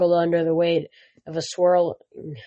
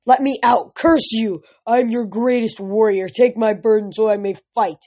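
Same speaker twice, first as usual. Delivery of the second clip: loud, higher cry of a giant in pain; bellowing in agony; 4 semitones higher, 4 dB louder than usual. Acted. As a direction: roar it out under a crushing weight. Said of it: "Atlas bellowed in agony".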